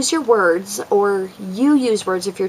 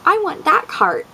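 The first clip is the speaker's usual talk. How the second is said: put-on high, eager little-girl voice; daughter wanting the cart; 10 semitones higher, slower than usual. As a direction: play the toddler insisting on the cart. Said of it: "usually she's like".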